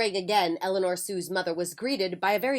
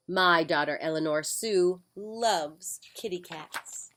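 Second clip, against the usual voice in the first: plain reading voice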